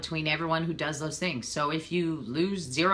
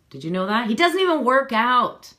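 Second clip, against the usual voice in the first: louder, higher mock outrage; teasing exasperation; 6 semitones higher, 9 dB louder than usual. Real, not acted. Telling viewers husband skips workouts, mock outraged. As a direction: tease about him, loud and high.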